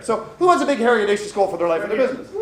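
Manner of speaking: raising the energy to ask the room again; pumped-up question. Real, not acted. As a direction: fire up the crowd with it. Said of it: "flat in or flat out".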